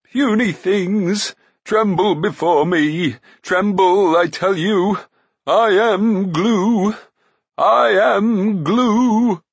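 loud, high, long-drawn quavering proclamation; crying giant creature. A narrator's reading. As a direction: bellow slow threats like a wobbly giant. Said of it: "A choking noise bubbled in the creature's throat as it cried".

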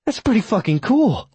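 put-on high, impressed young woman's voice; awed young woman. A narrator's reading. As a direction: gush with youthful awe.

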